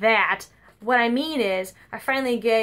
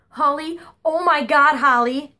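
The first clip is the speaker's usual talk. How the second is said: higher and louder, alarmed; upset grandma; 4 semitones higher, 6 dB louder than usual. Retold or imitated. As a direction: call out in a panic as grandma.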